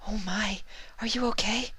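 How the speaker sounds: very high, soft whispered concern; gentle whisper. A narrator's reading. Pegasus finding injured Trixie, gentle high whisper. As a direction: whisper it like a timid carer. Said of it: "said the Pegasus gently".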